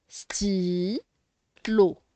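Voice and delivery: "Stylo" split into sty... lo, long pause between; syllable by syllable. Shown as a demonstration. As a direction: sound out "Stylo" in two drawn-out syllables. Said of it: "On sépare les syllabes".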